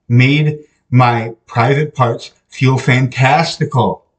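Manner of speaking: usual speaking voice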